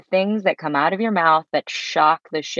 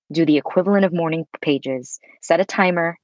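normal talking voice

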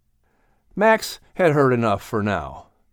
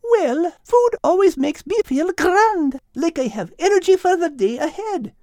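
put-on young girl voice. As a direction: ask it like a puzzled girl. Kate's lines.

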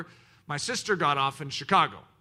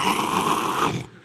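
unvoiced laugh; 5 dB louder than usual. Real, not acted. Laughing at porters story.

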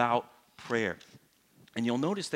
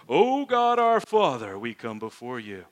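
mocking the sudden holy voice people use to pray; mock spiritual prayer voice; 8 dB louder, slower than usual. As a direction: parody the churchy prayer voice. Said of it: "their spiritual voice comes out".

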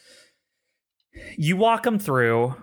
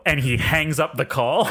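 normal talking voice

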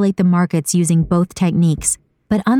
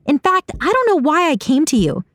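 high-pitched, heated dismissal; fed-up high character voice, not shouted; exasperated anger; 10 semitones higher than usual. A narrator's reading. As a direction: turn on them, voice pitched high. Saga angrily leaves the argument.

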